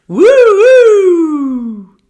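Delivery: loud, high police siren wail; siren imitation. Teen driver recreating the police siren behind him, loud wail. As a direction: mimic the siren that made his heart drop.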